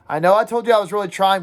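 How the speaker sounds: usual speaking voice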